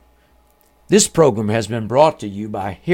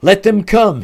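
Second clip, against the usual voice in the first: higher, louder call; shouted command; 7 semitones higher, 6 dB louder than usual. A narrator's reading. The Father calls to the believers waiting at the gate, shouting. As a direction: call it out as a ringing order. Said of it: "he shouts".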